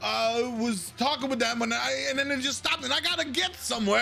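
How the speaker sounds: high-pitched, rambling complaint from a fussy noble; pompous noble rant. Acted. Noble complaining to the guard about his caravan, in a high fussy voice. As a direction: whine about your ruined caravan.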